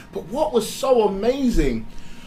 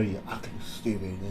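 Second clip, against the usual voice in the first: low, much softer, bitter self-talk; soft, low insult to herself in the mirror; muttered self-insult; 10 semitones lower, 12 dB quieter than usual. Retold or imitated. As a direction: mutter it at your own reflection.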